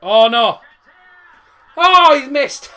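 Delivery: loud, high cry of disbelief; shocked shout. Real, not acted. Reacting to a missed chance live.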